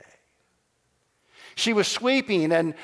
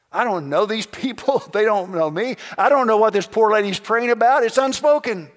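loud, high protest to God; exasperated protest; 5 semitones higher, 7 dB louder than usual. Acted. Bruce argues with God about strangers, exasperated. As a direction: plead incredulously, voice raised.

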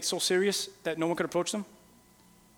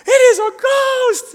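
very high, loud, slow shriek of fear; terrified shriek; 14 semitones higher, 11 dB louder, much slower than usual. Retold or imitated. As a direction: scream like you've seen a ghost. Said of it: "Peter's like".

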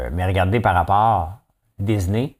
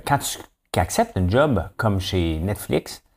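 normal talking voice

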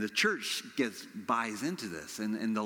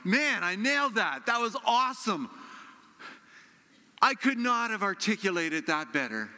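mock self-congratulation; 8 semitones higher, 4 dB louder than usual. Preacher recalling walking away from his sermon, hammed-up triumph. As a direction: play up being thrilled with your own performance.

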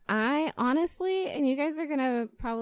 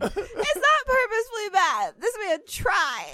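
indignant laughing protest; 11 semitones higher than usual. Real, not acted. Defending The Room to friends, high-pitched laughing protest. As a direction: protest through a laugh, voice jumping up.

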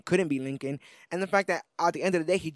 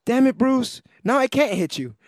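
loud, higher, hammed-up frustration; mock exasperation; 8 semitones higher, 5 dB louder than usual. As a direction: blurt it out like a friend who was just disarmed by kindness. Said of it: "The next thing that comes out is".

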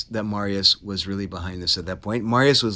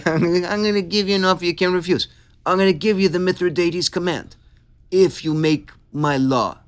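put-on dealmaker voice, pitched up high; Sulpicius; 6 semitones higher than usual. Shrewd politician making old Marius an offer, put-on character voice. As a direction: make the offer in a higher character voice. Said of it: "Like that".

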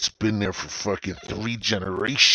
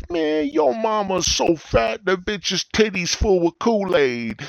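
put-on high, slow kid voice trading insults; mock a kid on the school bus roasting; 12 semitones higher, 4 dB louder, slower than usual. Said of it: "we just would sit there".

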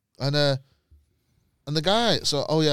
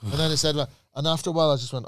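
usual speaking voice

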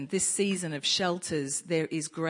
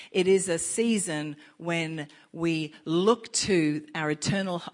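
preaching; 5 dB louder than usual. Acted.

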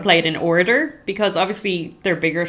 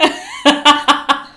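laugh; 9 semitones higher, 6 dB louder than usual. Real, not acted. Laughing at ship song.

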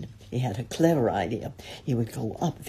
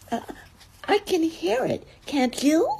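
high, nervous little squeak; Piglet, anxious; 12 semitones higher than usual. A narrator's reading. Piglet nearing the trap with Christopher Robin, anxious. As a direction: ask it in a tiny worried voice. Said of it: "said Piglet anxiously as they got near".

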